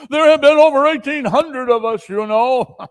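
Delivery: high, loud booming question; jolly giant ghost. Acted. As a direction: boom it out warmly.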